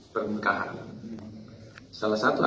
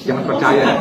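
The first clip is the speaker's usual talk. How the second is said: louder, laughing warning not to believe it; don't believe it, laughing and loud; emphatic warning; 8 dB louder than usual. Real, not acted. Speaker warning the wives, laughing and emphatic.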